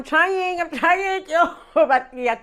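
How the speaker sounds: high, loud, slow laughing words. Real, not acted. Describing her frantic budgeting to viewers, high loud laughter. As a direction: crack up at her own scramble.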